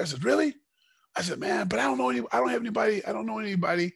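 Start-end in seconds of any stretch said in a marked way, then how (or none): 0.2-0.5 a high, loud, amazed "Really?"; astonished really. Retold or imitated. To Rorion Gracie, offered a flight to Brazil, astonished high. Retold reaction to free trip. 1.4-3.9 high, rushed eager worry; eager excited protest. Retold or imitated. To Rorion Gracie, fretting over Brazil, high and eager.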